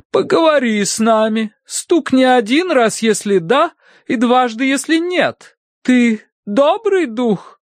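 high, insistent, irritated voice; раздражённый медиум. A narrator's reading. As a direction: instruct the spirit impatiently as a medium. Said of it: "продолжала мадам с явным раздражением". (раздражённо, высоким голосом медиума)

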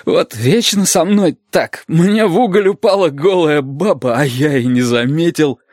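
high-pitched, aggrieved sailor voice; put-on voice of a shaken, grumbling sailor; rattled sailor. A narrator's reading. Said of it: "Из-за клацающих зубов казалось, что голос моряка".

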